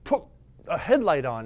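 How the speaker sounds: high, loud mocking impression; imitating partner. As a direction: parrot her advice teasingly. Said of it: "And she's always like".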